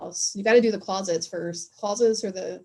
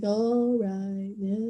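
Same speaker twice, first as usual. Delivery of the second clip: lightly voiced mopey cartoon dog; sad cartoon dog; much slower than usual. Friends mocking a blue cartoon dog, mildly mopey. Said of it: "sad all the time".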